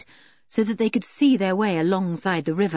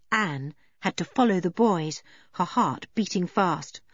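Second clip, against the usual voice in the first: ordinary delivery, nothing marked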